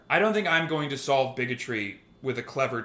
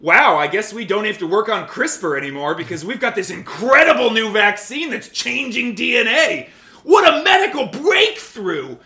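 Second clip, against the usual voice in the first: much higher, louder, gushing fake amazement; sarcastic mock awe; 7 semitones higher, 9 dB louder than usual. A commenter mocking an anti-vaxxer with gushing sarcastic awe. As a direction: ham up the wonder to mock them. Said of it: "Another guy taking a cue from me was like".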